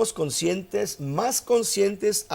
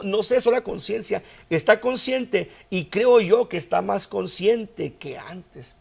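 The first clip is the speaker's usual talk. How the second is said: usual speaking voice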